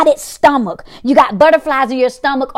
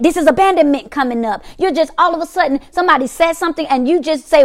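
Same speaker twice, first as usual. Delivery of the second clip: ordinary talk, nothing marked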